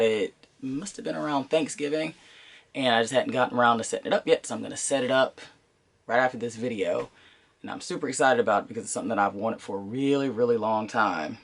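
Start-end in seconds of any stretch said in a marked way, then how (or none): none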